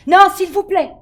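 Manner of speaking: a plea that bursts out too loud; bursting out. A narrator's reading. Girl begs the librarian not to call mom, bursting out. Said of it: "Ma voix éclate. Ce n'est pas tout à fait un cri".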